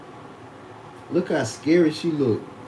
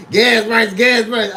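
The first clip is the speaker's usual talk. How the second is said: higher, louder fretful repeat; mock gas-price whining; 7 semitones higher, 7 dB louder, slower than usual. Mimicking folks down south fretting, high loud whine. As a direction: parrot the worriers in a raised nagging voice. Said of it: "Motherfuckers be like".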